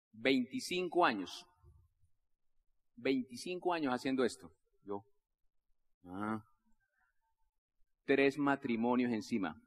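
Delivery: low, slow, heavy delivery; world-weary veteran. Retold or imitated. Veteran engineer tells newcomer about his years, weary. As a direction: let each phrase sink down slowly.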